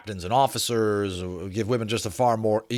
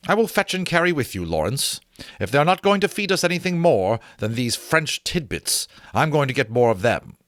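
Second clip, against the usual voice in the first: higher, faster hearty officer; Chenery, jovial; 6 semitones higher, 4 dB louder than usual. Acted. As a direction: bounce up for the food, higher.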